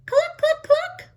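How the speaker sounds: high clucking hen. Acted. Hen voiced in story.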